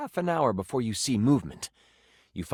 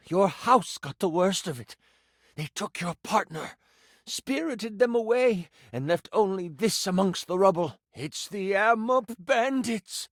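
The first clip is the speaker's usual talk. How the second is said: priest reporting an abduction, higher on the reveal; grave village priest; 5 semitones higher than usual. Acted. Voices the priest breaking bad news.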